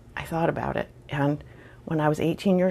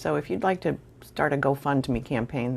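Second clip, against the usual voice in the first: normal talking voice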